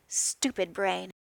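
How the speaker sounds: ordinary delivery, nothing marked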